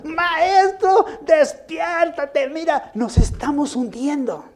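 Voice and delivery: high, loud, drawn-out cry of alarm; panicked plea. Retold or imitated. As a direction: grab him and beg him to wake. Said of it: "Yo creo que lo agarraron".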